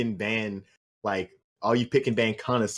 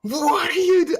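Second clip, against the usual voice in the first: very high mock outburst; mock flaming; 5 dB louder, slower than usual. Caster pretends to flame the player before interviewing him, high-pitched mock outburst. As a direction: squeal a joking telling-off. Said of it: "so we can flame him".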